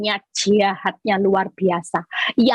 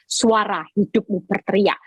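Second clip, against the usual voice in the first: ordinary talk, nothing marked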